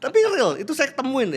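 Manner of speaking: high, loud burst of emphasis; excited. Real, not acted. To the host about real interview findings, excited high burst. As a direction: blurt it out, thrilled. Excited insistence after laugh.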